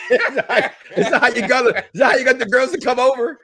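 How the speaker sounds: high, loud question through laughter; laughing incredulity. Real, not acted. Host teasing co-host about his pickup method, laughing. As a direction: crack up while asking in disbelief.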